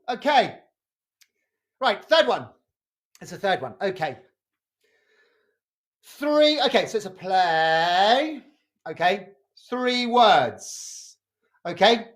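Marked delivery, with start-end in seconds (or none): none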